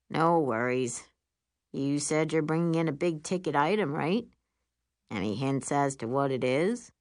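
deep, manly voice; low-pitched, easygoing boyfriend; Will. A narrator's reading. Boyfriend asking about the auction item, deep and easygoing. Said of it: "says Will's deep, manly voice".

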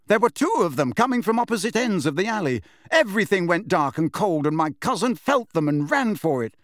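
put-on urgent boy pleading his case; Harry, urgent. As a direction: get the story out before being stopped.

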